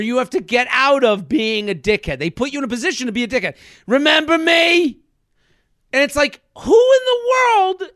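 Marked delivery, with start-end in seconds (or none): 3.9-5.0 thrust the question at him; aggressive greeting. Retold or imitated. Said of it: "such an aggressive way".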